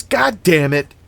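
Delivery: loud, high outburst of annoyance; exclaimed curse. A narrator's reading. Annie's robe blows open, she curses loudly. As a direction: curse loudly so it carries across a yard. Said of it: "echoed across the yard".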